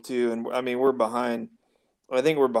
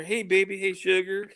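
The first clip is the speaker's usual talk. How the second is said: southern drawl; 7 semitones higher than usual. Retold or imitated. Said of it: "somebody be on there".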